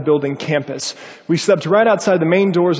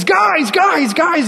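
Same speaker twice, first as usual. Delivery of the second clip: high, loud imitated yell; excited high-pitched retold shout; 14 semitones higher, 5 dB louder, much slower than usual. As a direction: imitate someone yelling urgently across a room. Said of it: "this guy just yelling at the top of his lungs".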